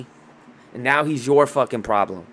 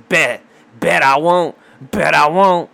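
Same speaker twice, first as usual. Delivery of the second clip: louder defiant challenge; loud taunt; 3 semitones higher, 6 dB louder, slower than usual. Acted. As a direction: throw it out like a dare.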